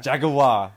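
JAGUAR in three beats, British style; loud "JAG-u-ar" for jaguar; British pronunciation. Shown as a demonstration. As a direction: add a middle syllable to jaguar, British-style. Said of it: "do the British pronunciation".

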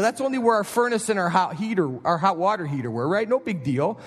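usual speaking voice

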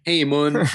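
Hamun said HAY-moon; first vowel as "ay". Shown as a demonstration. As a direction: start Hamun like the word "hay". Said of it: "I can't tell if it's Hamun or Ham".

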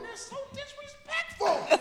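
high, offended voice. Retold or imitated. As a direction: play the prim, offended guest.

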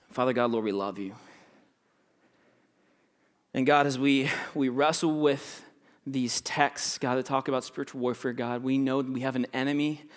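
low, soft prayer voice; leading prayer. Real, not acted. Pastor praying to God with his congregation, hushed prayer. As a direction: pray quietly and unhurriedly, pausing between phrases.

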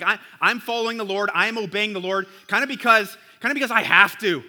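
mock the reluctant obeyer; half-hearted believer. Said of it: "that rut of obedience where, like".

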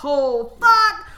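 loud, high burst on the curse; excited outburst. Real, not acted. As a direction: blurt it out, jumping high at the end. Reaction to new chapter title.

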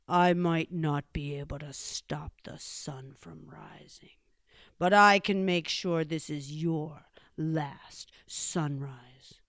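low, hushed threat; slow down into a dark bear threat; menacing Great Bear. Acted.

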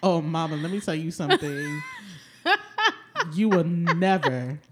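sassy mom lecturing a plant, laughing; mock scolding.

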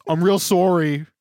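loud, high Aussie sorry; a hammed-up Australian apology; mock Australian apology. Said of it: "descended into Australia".

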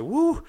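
high, loud "woo"; excited whoop. Retold or imitated. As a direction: let out a sudden rising whoop. Acts out his delight at order.

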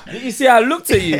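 laughing loud and high through the words; cracking up while asking. Real, not acted. To her co-host, laughing loudly at herself. Laughing about not knowing homosexual.

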